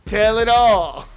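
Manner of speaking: loud, drawn-out playful holler; mock proclamation. Playfully echoing "telling it all" after a laugh, loud mock proclamation. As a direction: stretch it out big and loud. Jokingly echoing her own quote.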